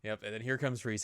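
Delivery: usual speaking voice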